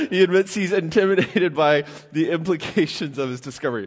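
reading on through real laughter; losing a straight face. Real, not acted. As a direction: try to get the line out while laughing. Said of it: "can't keep a straight face anymore".

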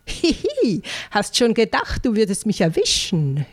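high, breathless teasing giggle; a cheeky little ant taunting. Acted. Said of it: "hörte ich die atemlose Ameise mit hoher Stimme rufen".

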